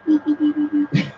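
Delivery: high-pitched mock scuffle chatter; squeaky fighting noises. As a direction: imitate a tiny squabble with squeaks. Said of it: "they're fighting like".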